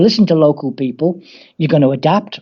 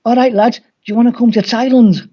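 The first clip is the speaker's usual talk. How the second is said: higher-pitched Scouse caller; Scouse accent; 6 semitones higher, 3 dB louder than usual. Retold or imitated. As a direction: put on a lilting Scouse phone greeting. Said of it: "the Scouse accent".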